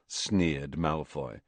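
usual speaking voice

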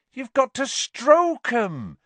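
high, slow, loud explanation; stating the obvious. A narrator's reading. Hagrid explaining the books to the class, as if obvious. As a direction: stretch it as if it were obvious. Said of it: "as though this was the most obvious".

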